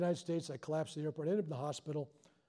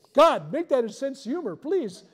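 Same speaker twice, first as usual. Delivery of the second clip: loud, high mock prayer to God; pleading outburst, played for laughs; mock desperate plea; 8 semitones higher, 12 dB louder than usual. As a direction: beg loudly, hammed up for the joke.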